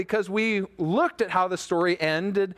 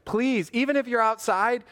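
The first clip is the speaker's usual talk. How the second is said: ordinary talk, nothing marked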